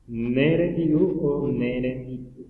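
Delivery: slow, syllable by syllable: "Nere piu o nere mitu"; slow contrasting forms. Shown as a demonstration. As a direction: sound out "piu" and "mitu" slowly. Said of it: "va a ser de re mitu". (pronunciación lenta de "piu" y "mitu")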